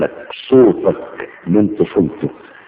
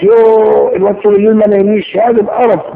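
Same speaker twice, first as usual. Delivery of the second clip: high, loud, fast exasperated whine; exasperated complaint; 12 semitones higher, 4 dB louder than usual. Retold or imitated. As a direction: whine like a fed-up mother. Exasperated mother complaining about baby. (تذمر عالي ومتضايق)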